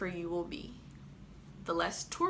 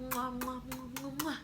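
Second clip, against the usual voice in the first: blown kisses; 5 semitones higher, 5 dB quieter than usual. Real, not acted. Blowing kisses at sign-off.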